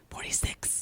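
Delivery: unvoiced aside correcting the age; whispered correction. Imitating Stacy whispering a correction of Darcy's age. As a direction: whisper it as a sly jab. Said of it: "Stacy's like".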